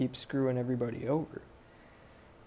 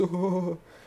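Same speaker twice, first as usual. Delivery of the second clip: mocking laugh; 7 semitones higher, 4 dB louder than usual. Gang laughs at him.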